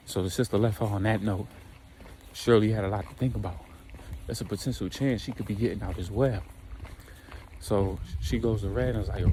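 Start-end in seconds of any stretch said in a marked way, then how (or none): none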